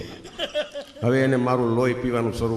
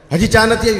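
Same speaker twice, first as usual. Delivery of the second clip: high, loud angry demand; hot-tempered outburst; 10 semitones higher, 9 dB louder than usual. Retold or imitated. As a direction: snap loudly like a short-fused military husband. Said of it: "મિલિટરીમાં મગજ ગરમ". (ગુસ્સામાં ઊંચા અવાજે રાડ)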